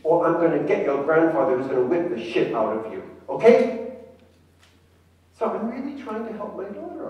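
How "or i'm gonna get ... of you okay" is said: loud menacing threat, rising to a yell; threatening grandmother. Retold or imitated. Grandmother threatening her grandson, loud and harsh. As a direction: threaten the boy with his grandfather. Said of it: "I'm gonna tell my grandson".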